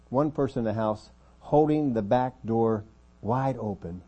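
normal talking voice

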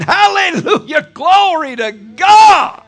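high, loud, drawn-out praise cry; ecstatic shout. Retold or imitated. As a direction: shout it to heaven in rapture. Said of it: "I start shouting".

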